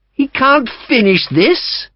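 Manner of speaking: high, slow querulous protest; fussy old officer. A narrator's reading. The Brigadier objects to leaving, high reedy old man. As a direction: whine about the bandage.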